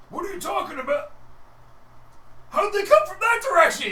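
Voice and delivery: high, loud alarmed yelling; a guard shouting in shock. Acted. Guard yelling about intruders from the wrong side.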